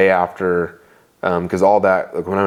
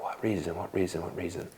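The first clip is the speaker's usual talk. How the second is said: very soft, fading questions; whispered-soft pleading to God; near-silent plea; 16 dB quieter than usual. Real, not acted. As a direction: ask it barely aloud, overwhelmed. Emotional retelling, pleading God.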